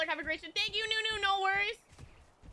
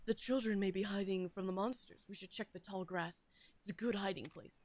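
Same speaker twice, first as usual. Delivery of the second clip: lightly voiced, lower game character; 5 semitones lower, 5 dB quieter than usual. Streamer reading a game hint about hiding children, lightly in character. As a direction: read the line softer and lower, in character.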